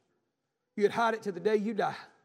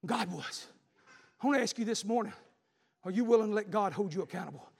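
ordinary delivery

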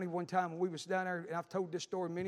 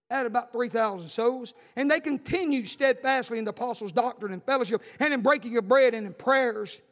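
loud, high scripture proclamation; preaching at full force; 4 semitones higher, 8 dB louder than usual. Acted. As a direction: thunder the verses to the church.

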